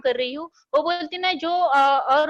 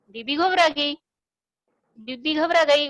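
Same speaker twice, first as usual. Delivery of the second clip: ordinary delivery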